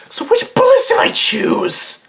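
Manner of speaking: very high, loud squawk; high-pitched outburst. Acted. Reader mocking the story's choice of bullets, high-pitched outburst. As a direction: blurt it out in a piercing falsetto.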